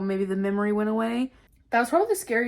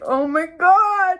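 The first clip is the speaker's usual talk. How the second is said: high, loud, drawn-out mock dread; hammed-up panicked exclamation; retold panic; 7 semitones higher, 6 dB louder, much slower than usual. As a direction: wail it slowly in exaggerated alarm. Retold panicked reaction to threat.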